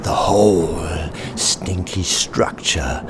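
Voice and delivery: ordinary delivery, nothing marked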